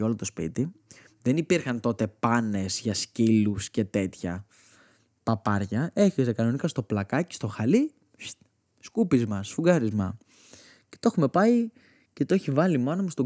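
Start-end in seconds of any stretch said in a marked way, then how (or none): none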